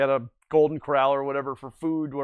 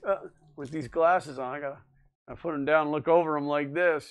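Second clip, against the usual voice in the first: ordinary delivery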